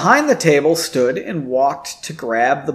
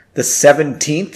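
plain reading voice

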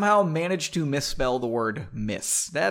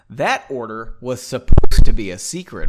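much louder council member; 3 semitones lower, 14 dB louder than usual. Acted. Council member loudly scolds Margot, loud. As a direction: bark it at the room. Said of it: "said loudly".

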